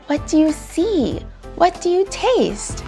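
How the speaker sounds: ordinary delivery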